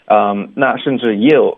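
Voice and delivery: ordinary talk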